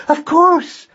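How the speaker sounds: very high-pitched urgent calling; frantic mother calling for help; urgent cry. A narrator's reading. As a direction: summon your son in a high, excited panic. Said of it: "she cried".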